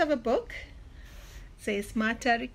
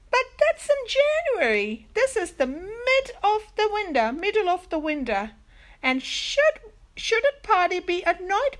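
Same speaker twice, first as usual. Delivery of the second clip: high, loud, acted disbelief; incredulous objection; 9 semitones higher, 6 dB louder than usual. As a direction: protest like children who can't believe it. Children object to winter New Year.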